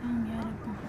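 low, soft exasperated sigh of words; tired muttered plea to God; weary groan. Real, not acted. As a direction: groan it under your breath.

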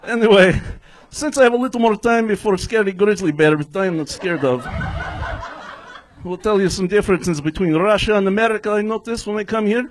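stand-up comic's put-on Russian accent; hammed-up Russian English; fake Russian accent. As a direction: play a Russian immigrant with a thick accent. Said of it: "somebody's doing a fake Russian accent".

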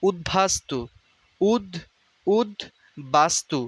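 slowed model of উদ্বাস্তু; slow syllable drill. Shown as a demonstration. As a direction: spell out উদ্বাস্তু slowly. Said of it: "এটার উচ্চারণ হবে".

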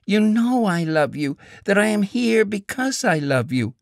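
high, earnest pleading woman's voice. A narrator's reading. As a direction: insist on her love, pleading. Woman pleading after sobbing.

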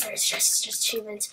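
normal talking voice

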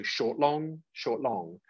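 "short, long, short, long" in rhythm, each "long" held; short-long stress. Shown as a demonstration. Teacher spells out the iambic pattern, short-long stress on "short, long". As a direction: say "short" briefly and stretch "long". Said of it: "you stress them".

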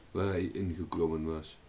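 ordinary delivery, nothing marked

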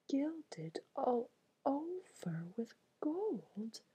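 ordinary delivery

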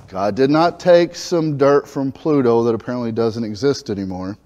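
normal talking voice

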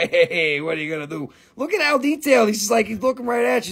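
ordinary talk

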